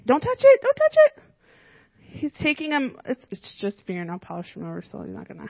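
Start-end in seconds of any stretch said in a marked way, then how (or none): 0.0-1.2 much higher, louder "don't touch it"; sharp warning. Real, not acted. Child reaching into the bowl.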